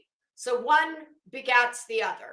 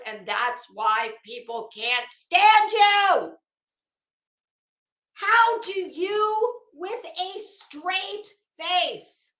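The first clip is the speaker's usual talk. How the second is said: louder, slow and deliberate, higher on how; emphatic scolding; 6 semitones higher, 6 dB louder, much slower than usual. Real, not acted. Telling Betty why people can't stand her, slow loud scolding. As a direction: lean into each word, incredulous.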